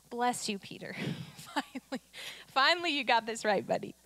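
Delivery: playful, chuckling imagined Jesus; Jesus teasing Peter. Acted. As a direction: congratulate a buddy with amused, soft relief. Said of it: "he probably didn't say it like that".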